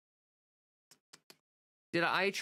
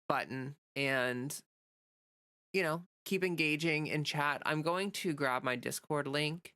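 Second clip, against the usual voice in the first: ordinary talk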